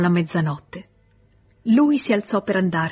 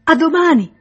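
loud, much higher call across a distance; 10 semitones higher, 8 dB louder than usual. A narrator's reading. Emma calling after Rodolphe across the river, loud call. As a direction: shout it after someone walking away. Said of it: "per gridargli ancora".

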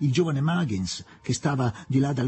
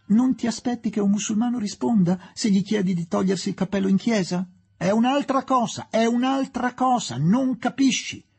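high, heated, insistent retort; exasperated arguing; 11 semitones higher, 4 dB louder than usual. A narrator's reading. Son arguing with the young woman, high-pitched and exasperated. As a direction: repeat yourself in mounting exasperation.

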